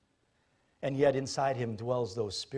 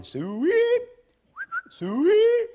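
much higher, louder carefree herdsman; pretend herdsman; 6 dB louder than usual. Imagined herdsman enjoying his day, high and carefree. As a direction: toss it off like a happy pig farmer.

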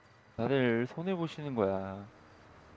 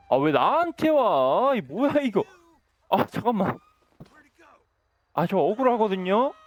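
loud, high-pitched complaint; play-acted outrage at being blamed; indignant protest; 7 semitones higher, 6 dB louder than usual. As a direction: protest innocence with raised, aggrieved voice. Protests being chased by game police.

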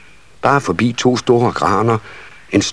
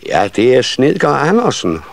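raised, higher-pitched call; mother calling out; 6 semitones higher than usual. A narrator's reading. Nissemor answering old grandfather, mildly raised higher voice. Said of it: "råbte nissemor".

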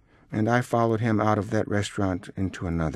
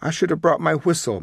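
high, needling complaint; overbearing and scornful; belligerent sarcasm; 8 semitones higher, 3 dB louder than usual. A narrator's reading. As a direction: goad the waiter. Father needling the old waiter.

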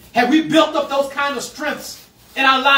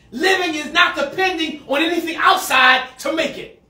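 preaching shout; 5 semitones higher than usual. Real, not acted. Pastor shouting a truth to the church. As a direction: proclaim it to the room.

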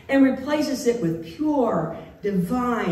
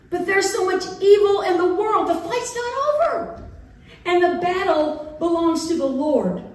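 much higher, rallying crusader tone; mock battle cry; 7 semitones higher than usual. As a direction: ham up the holy warrior.

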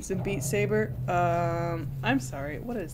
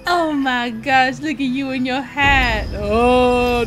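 delighted gush; 3 semitones higher, 9 dB louder, slower than usual. Real, not acted. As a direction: gush loudly, slowly, full of delight. Excited over baby's hat.

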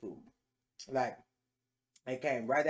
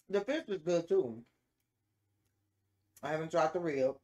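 talking while chewing food; muffled through a mouthful; mouth full, chewing; 2 semitones higher than usual. Real, not acted. Seen 2: Bites fried piece near -2s; lips closed, chewing through the words.

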